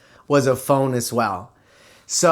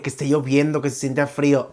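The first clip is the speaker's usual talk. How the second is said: normal talking voice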